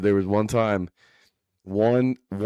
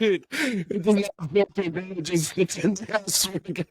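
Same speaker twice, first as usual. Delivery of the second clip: helpless laughter; 8 semitones higher than usual. Real, not acted. Friend defending his mask story, still cracking up. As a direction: insist through the giggles.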